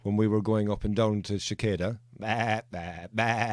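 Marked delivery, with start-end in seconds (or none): 2.2-3.5 playful imitation of a sheep's baa; sheep bleat. Mimicking sheep in the pens.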